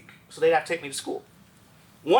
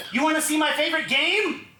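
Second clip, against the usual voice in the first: excited little sister; 10 semitones higher, 5 dB louder than usual. Retold or imitated. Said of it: "looked up at me and goes".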